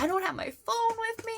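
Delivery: high-pitched mock panic; panicked. Idol struggling with fans' names, imitated panic. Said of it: "And he panicked".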